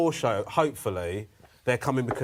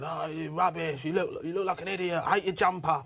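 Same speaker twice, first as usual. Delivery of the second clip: mock heckler shout; 5 semitones higher than usual. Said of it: "bloke just shouting at me".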